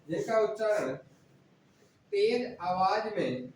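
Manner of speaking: words drawn out slowly for note-taking; slow dictation. Shown as a demonstration. Teacher dictates slowly to students who aren't writing. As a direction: spell it out slowly so they can write. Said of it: "लिखते तो नहीं भैया".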